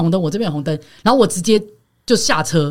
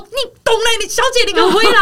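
retold angry shout; 14 semitones higher than usual. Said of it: "我说".

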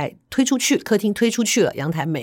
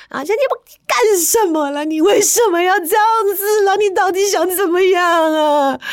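high, loud, desperate questioning; wife crying out at her husband; distraught wife; 12 semitones higher, 3 dB louder than usual. Retold or imitated. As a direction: demand answers in a panic. Wife voiced during the fight.

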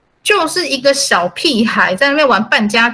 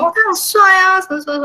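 mock starry-eyed fan, pitched way up; gushing student; 11 semitones higher, faster than usual. Student gushing about how handsome he is, mock starry-eyed. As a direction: gush like a smitten fan. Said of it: "然后那个学生就说".

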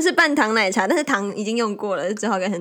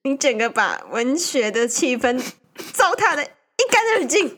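mock indignant; 4 semitones higher than usual. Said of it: "本来就没有。".

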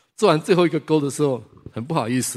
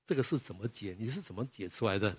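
quiet private question; 3 semitones lower, 12 dB quieter than usual. Retold or imitated. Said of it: "私下问他问题".

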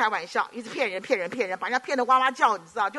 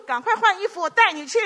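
higher, louder mock urging; urgent command; 8 semitones higher, 6 dB louder than usual. Pranking his friend on April Fools, loud urgent order. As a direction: push him to hurry up.

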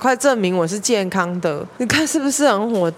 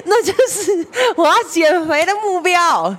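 higher, words broken by laughter; laughing; 9 semitones higher than usual. Real, not acted. Teller to audience, still laughing, higher.

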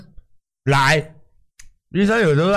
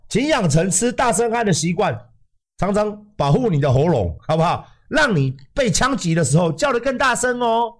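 higher, hammed-up TV ad pitch; mock commercial; 4 semitones higher than usual. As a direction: sell it like a cheesy infomercial.

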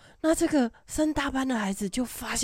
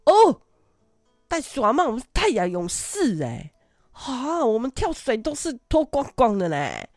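put-on high, loud protesting kid voice; mock indignant kids; 4 semitones higher, 7 dB louder than usual. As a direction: whine back like proud little warriors. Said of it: "然后他们就说".

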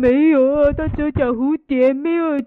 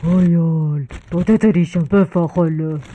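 low, muffled voice from inside a bag; muffled in hiding; 9 semitones lower, faster than usual. Acted. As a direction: talk to yourself low, sheltered from the din. Hides in plastic bag from noise. (躲在塑胶袋里低沉闷声自语)

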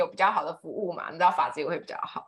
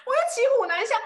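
laughing; 7 dB louder, faster than usual. Real, not acted. Laughing at her own plight.

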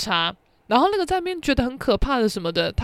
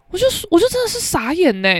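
ordinary delivery

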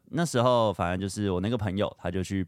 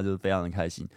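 ordinary talk

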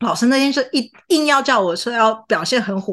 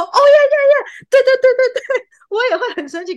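much higher and louder gushing yes yes yes; eager laughing agreement; 7 dB louder than usual. Retold or imitated. Student cheerfully agrees to cat cruelty being infuriating, laughing. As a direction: agree over and over while giggling. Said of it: "这样笑着讲".

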